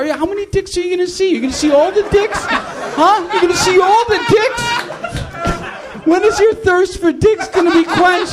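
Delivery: overacted jealous husband shrieking at his wife; hammed-up emotional husband. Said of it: "I'm trying to work on my acting".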